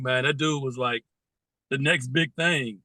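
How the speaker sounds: ordinary talk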